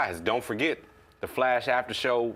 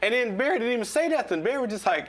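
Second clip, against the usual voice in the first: excited; 12 semitones higher than usual. Real, not acted. Excited retelling of Barry scene.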